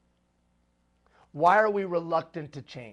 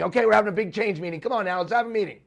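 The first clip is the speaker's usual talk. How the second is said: louder, higher, hurried meeting-caller voice; meeting rallier; 4 semitones higher, 6 dB louder, much faster than usual. Acted. Someone calling staff to a change meeting, hurried rallying. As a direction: round everyone up for a big meeting.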